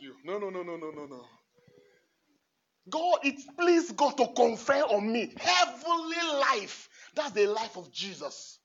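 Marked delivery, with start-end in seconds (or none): 2.9-6.9 loud, slower proclamation; preaching proclamation. Acted. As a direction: declare it over yourself with force. Preaching the prayer over himself.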